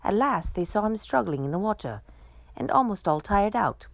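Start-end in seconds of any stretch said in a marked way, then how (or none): none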